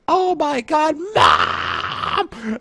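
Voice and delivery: high-pitched wail rising into a shout for mom; shrieking tantrum cry; wailing scream. Retold or imitated. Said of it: "let out this wail of a scream".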